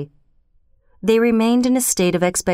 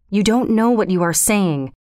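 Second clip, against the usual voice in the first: ordinary delivery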